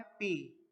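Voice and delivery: "पी" with a plain unaspirated p; "पी" said the Indian-British way, without breath; British-style P. Shown as a demonstration. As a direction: say "पी" as a clean p, no f. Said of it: "English के according pronounce करते हैं".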